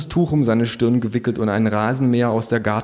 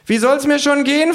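high, loud, grumpy retort; indignant shrill kangaroo; 5 dB louder than usual. A narrator's reading. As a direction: snap back in a high animal voice. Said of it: "fragt das Känguru".

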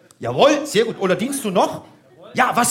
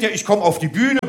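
ordinary delivery

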